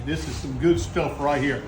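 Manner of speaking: ordinary talk, nothing marked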